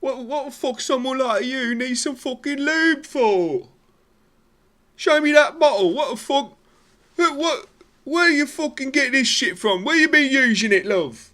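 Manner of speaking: high, loud mocking British girlfriend voice; shrill angry girlfriend. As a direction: screech it like an outraged wife. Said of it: "She said".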